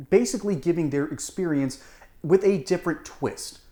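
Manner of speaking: ordinary talk, nothing marked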